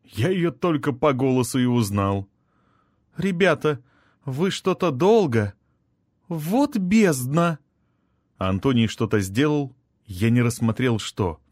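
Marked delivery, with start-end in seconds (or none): 3.2-7.6 put-on high-pitched girlish voice, taken aback; high, wide-eyed astonishment; astonished girl. A narrator's reading. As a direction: pipe up high like a surprised girl. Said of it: "появилась изумлённая Наила в виде конопатой девчонки".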